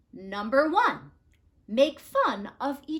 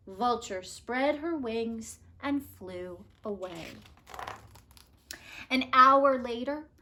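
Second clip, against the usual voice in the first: plain reading voice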